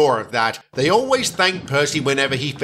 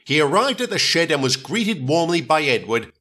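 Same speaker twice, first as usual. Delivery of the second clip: ordinary delivery, nothing marked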